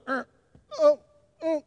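tiny imitated infant whimper; small, soft mock baby fussing noises. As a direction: make little waking-baby whimper sounds. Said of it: "first a little whimper".

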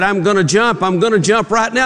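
high-pitched, insistent mock threat; mock jump threat. Man on the ledge to counselor, mock-desperate threat.

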